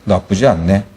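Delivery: low, indifferent imitated remark; emotionless. Shown as a demonstration. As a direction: drop it with no feeling at all. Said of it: "아무 감정 없는 멘트로 이러는". (감정 없이 낮고 무심하게)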